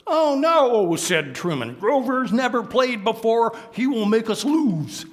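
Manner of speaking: put-on high, loud, whiny kid complaining; a bratty boy who doesn't want Grover; Truman voice. Truman refusing Grover on his team, high whiny kid voice. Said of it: "letting me do the voices".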